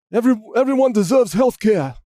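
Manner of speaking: mock a senator's slogan shouting; Bernie Sanders impression. Senator saying everyone deserves care, hammed-up impression. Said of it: "my impression of Bernie Sanders".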